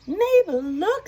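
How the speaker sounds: very high, loud call to Mabel; excited cow voice. Acted. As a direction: squeal her name in delight. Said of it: "said Molly".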